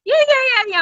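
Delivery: high, rapid-fire string of assurances; mock insistence. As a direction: imitate someone protesting too eagerly. Man retold insisting he is there.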